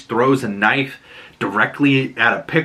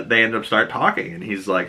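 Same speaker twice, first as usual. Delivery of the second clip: usual speaking voice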